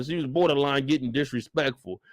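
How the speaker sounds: usual speaking voice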